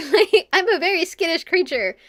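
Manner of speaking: ordinary talk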